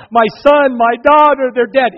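loud, high anguished cry of grieving parents. Retold or imitated. Parents at the rubble crying out for their dead children, loud and high. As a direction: parents wailing over the collapsed school. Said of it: "parents that were there crying out".

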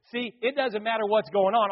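ordinary talk

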